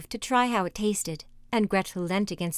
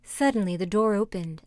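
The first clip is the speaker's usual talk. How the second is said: ordinary delivery, nothing marked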